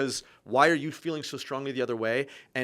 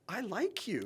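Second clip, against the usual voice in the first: high, soft, flummoxed imitation; almost crying; 6 semitones higher, 8 dB quieter, slower than usual. Flummoxed father facing his son, almost crying. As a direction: say it small, as if near tears. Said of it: "almost crying, and he goes".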